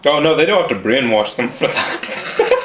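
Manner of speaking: normal talking voice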